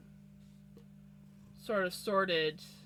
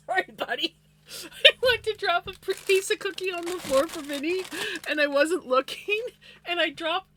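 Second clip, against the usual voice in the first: louder, higher, laughing through the words; 8 semitones higher, 7 dB louder than usual. Real, not acted. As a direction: tell it while cracking up. Laughing while telling.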